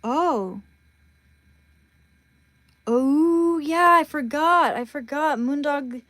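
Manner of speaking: loud, high burst of remembering; sudden realization. Real, not acted. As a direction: blurt it out as it comes back to you. Suddenly remembers texting Moondog.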